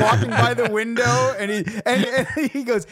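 talking through real laughter, pitch up; giggling out the words mid-story; laughing. Real, not acted. Cracking up while telling friends about his brother.

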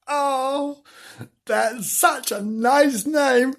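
put-on high, slow little girl's voice; child character voice. Imogen praising the cat's name, high childlike voice. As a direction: lift into a sweet child's voice.

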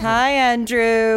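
high, loud, drawn-out hello. Real, not acted. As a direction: sing out a slow hello to a little one. Greets a small child.

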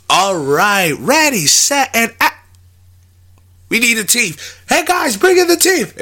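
high-pitched, hurried-in film crew call. Acted. Crew rushing the actor to bring in the teeth, high mock call-out.

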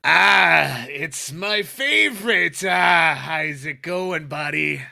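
loud, high, slow jovial boss greeting; hammed-up fight boss greeting. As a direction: greet him like a gruff friendly boss.

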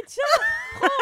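loud. Real, not acted. Offering the drink loudly.

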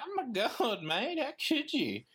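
high, slow, aghast puppet voice; Grover voice. Retold or imitated. Host voicing Grover scolding the reader, high and aghast. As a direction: gasp it out like a betrayed puppet. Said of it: "he's like".

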